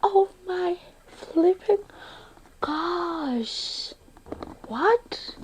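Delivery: soft, high, hushed amazement; hushed disbelief. Real, not acted. To viewers over her laptop, hushed and high. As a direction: breathe it out, stunned.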